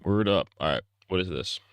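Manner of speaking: ordinary delivery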